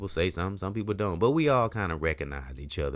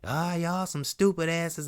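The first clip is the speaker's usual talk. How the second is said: much higher, slow jeering taunt; mocking passerby; 12 semitones higher than usual. Passersby laughing at the cleanup crew, jeering high taunt. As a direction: imitate a passerby jeering from a car. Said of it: "my people riding by laughing".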